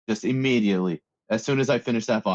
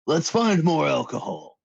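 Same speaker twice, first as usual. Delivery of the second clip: slow, raised-pitch party character voice; in-character line; 5 semitones higher, slower than usual. Acted. Player speaking as his character, urging more alcohol, slow and raised. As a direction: stretch it out as your drunk character. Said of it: "I'm gonna say".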